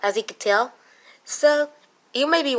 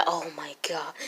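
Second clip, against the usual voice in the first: whispered aside; 5 semitones lower, 14 dB quieter than usual. Real, not acted. Frustrated aside.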